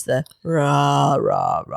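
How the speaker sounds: low, rough mock rasp; husky voice imitation. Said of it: "husky voice".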